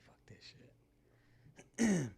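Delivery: slow harsh whisper; whispered curse. Retold or imitated. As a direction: breathe the curse out slowly. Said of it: "I'm like".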